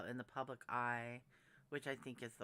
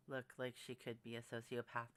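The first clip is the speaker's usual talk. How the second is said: ordinary talk, nothing marked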